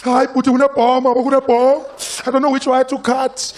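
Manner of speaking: put-on high and loud, flustered South African accent. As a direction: fuss over the bomb in a heavy South African accent. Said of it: "he'd be like".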